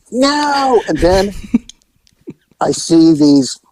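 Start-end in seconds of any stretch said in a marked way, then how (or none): 0.1-0.8 high, pleading no; dismayed no. Retold or imitated. Officer dreading the birth, a high no. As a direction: dread the answer. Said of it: "And I'm like".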